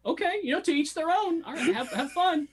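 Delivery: very high put-on character voice; bemused helper. Passenger at the window leaves the goblin to it, high awkward voice. Lars gives up offering help.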